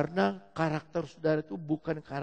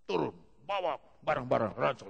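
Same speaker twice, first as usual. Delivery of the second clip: ordinary delivery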